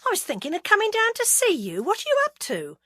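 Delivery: breezy and playful; Roy, young PR man. A narrator's reading.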